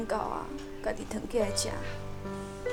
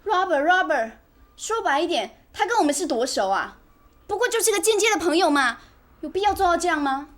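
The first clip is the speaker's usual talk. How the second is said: raised, louder voice with punched words; angry protest; 4 semitones higher, 5 dB louder than usual. Acted. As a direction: argue it indignantly at your husband. Wife quarrels with husband over friend. (提高嗓门生气地抗议)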